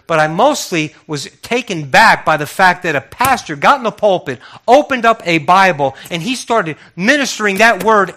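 fired-up preacher in full cry; preaching at full force. Real, not acted. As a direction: build it up, punching out each phrase.

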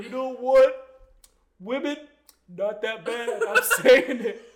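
hammed-up announcement with pauses between phrases; bold mock declaration. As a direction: proclaim it like a brave stand. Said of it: "it has that energy of him being like".

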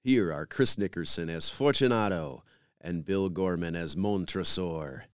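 ordinary delivery

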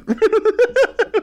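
high burst of laughter. Real, not acted. Joking about trolls with friends, high laugh. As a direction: crack up at your own punchline.

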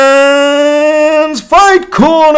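booming high, slow announcer; big boxing-style segment intro. Pretend. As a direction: call it out like a ring announcer.

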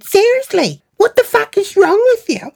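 very high, exasperated demand; imitated angry friend. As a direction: mimic her outrage way up high. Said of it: "she was talking to me like that".

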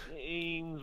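half-swallowed name, barely getting it out; kinda mumbling. Retold or imitated. Exhausted runner mumbling to his hero. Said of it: "like, kinda mumbling".

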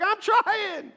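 high, put-on whiny voice mocking the excuse. Pastor mocks the congregation's excuse in a high whiny voice. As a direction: whine it like a lazy runner. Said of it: "Everybody's like".

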